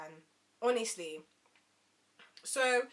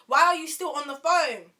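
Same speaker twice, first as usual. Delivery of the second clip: louder, emphatic questioning; exasperated demand; 4 semitones higher, 9 dB louder than usual. Retold or imitated. Asking the date about his call, loud exasperation. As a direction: demand an answer, fed up.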